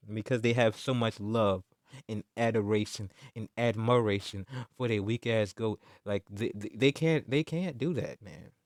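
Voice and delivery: ordinary talk, nothing marked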